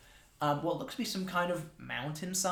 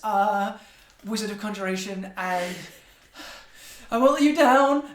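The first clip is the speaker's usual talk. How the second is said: nervous, stammering underling, higher and louder as it goes; flustered Spindle; 4 semitones higher, 6 dB louder than usual. Acted. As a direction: stammer with awe, tripping over fillers. Voices a flustered, overawed Spindle.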